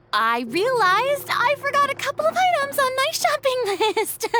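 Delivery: high, awkward laughing excuse; nervous laugh. Acted.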